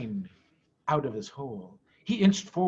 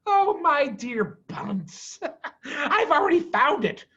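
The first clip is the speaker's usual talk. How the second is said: slow, higher, gloating with a laugh; crafty Bean; 9 semitones higher than usual. Acted. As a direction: purr it out, very pleased with himself. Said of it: "said the crafty Bean".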